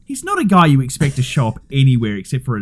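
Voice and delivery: ordinary talk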